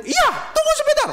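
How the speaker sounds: very high, mocking entitled lady; dismissive shrill reply. Rude mother dismissing flight attendant, high and snooty.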